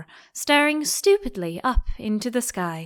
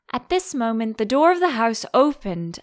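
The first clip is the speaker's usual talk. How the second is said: plain reading voice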